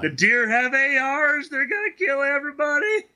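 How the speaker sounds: mock-hysterical warning; mock panic. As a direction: wail it like a doomsayer. Mocking paranoid gun panic.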